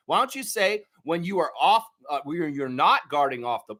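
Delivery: ordinary talk, nothing marked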